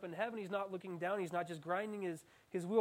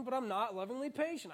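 ordinary talk, nothing marked

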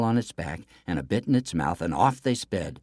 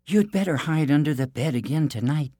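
higher, lightly voiced princess; princess character voice; 7 semitones higher, 3 dB louder than usual. A narrator's reading. Princess warns the prince to hide, lightly voiced. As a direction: advise in a lifted princess voice.

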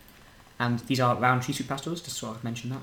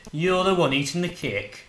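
drawn-out, higher-pitched Yorkshire impression; Yorkshire accent; 5 semitones higher, slower than usual. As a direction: quote it slowly in a put-on Yorkshire accent. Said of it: "I don't do Yorkshire accent anymore".

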